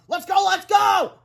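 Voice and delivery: high, loud urgent yell; screamed. A narrator's reading. Woman screams at the superhero to flee. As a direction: scream to hurry him along. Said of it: "screamed Miss Anthorp".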